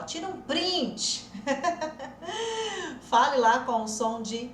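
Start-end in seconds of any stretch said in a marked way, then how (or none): 0.0-1.3 a slow, higher mock of how everyone says it; imitating learners. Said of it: "que todo mundo fala". 1.4-3.0 a short high laugh. Real, not acted. After mocking print.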